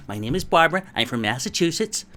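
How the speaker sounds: perky mock American accent. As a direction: introduce yourself as a cheesy American. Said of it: "speaking with an American accent".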